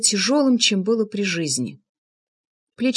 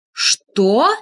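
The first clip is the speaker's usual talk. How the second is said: stunned, indignant question; taken aback; 5 dB louder than usual. A narrator's reading. Narrator accused by Влад, taken aback. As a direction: recoil at the sheer cheek of it. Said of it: "Я даже опешила от такой наглости".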